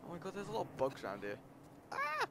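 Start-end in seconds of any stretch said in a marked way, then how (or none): 1.9-2.3 short, very high scream; high yelp of alarm; startled shriek. Real, not acted. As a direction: shriek in sudden fright. Startled by bugs in game.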